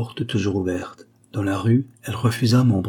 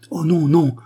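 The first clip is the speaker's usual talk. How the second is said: higher, louder, lively refusal; a lively, raised-voice objection; quick protest; 7 semitones higher, 5 dB louder than usual. A narrator's reading. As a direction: protest at once, voice lifted. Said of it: "dit-elle vivement".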